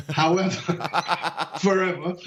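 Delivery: laughing through the word, barely holding it together; chuckling at his own age joke. Real, not acted. Laughing after joking to the host that he's only thirty-nine. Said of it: "I'm only thirty-nine".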